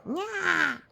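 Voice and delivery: high teasing nyah; mock a rival; taunting. Nasty nightingale taunts.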